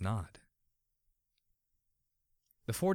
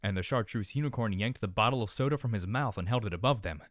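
ordinary delivery, nothing marked

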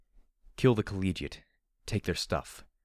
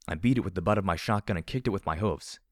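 ordinary delivery

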